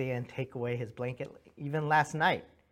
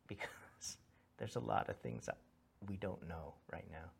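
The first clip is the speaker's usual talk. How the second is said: hushed confession; 6 semitones lower, 9 dB quieter than usual. Real, not acted. Intimate confession of uncertainty.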